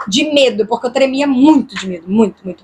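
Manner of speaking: normal talking voice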